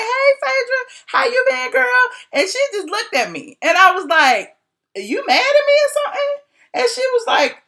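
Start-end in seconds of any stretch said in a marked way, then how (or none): 0.0-1.8 high, over-friendly hello; mock sweet greeting. Blogger walking up to an estranged acquaintance, mock sweet. 5.0-6.4 high, quick, innocent asking; mock innocent question. Blogger checking if her acquaintance is angry, mock innocent.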